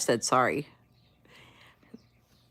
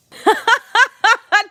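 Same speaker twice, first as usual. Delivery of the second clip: high, loud cackling laugh; burst of laughter; 10 dB louder than usual. Real, not acted. Amused by chat mid-story.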